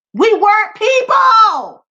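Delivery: shouted out, slow and heavy; impassioned shout. Real, not acted. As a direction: cry it out in outrage. Angry rant about slavery.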